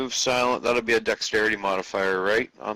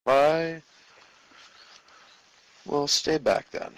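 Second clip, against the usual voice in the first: higher, slow in-character reply; 7 semitones higher, slower than usual. Acted. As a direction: answer as the character, slowly. Player voices character reply.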